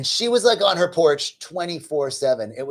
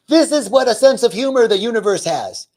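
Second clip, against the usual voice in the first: ordinary talk, nothing marked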